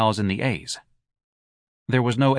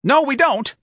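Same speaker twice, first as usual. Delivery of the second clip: very high, loud denial; shrill, panicky clerk; 6 dB louder, slower than usual. A narrator's reading. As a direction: snap it back shrilly. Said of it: "answered in a shrill voice".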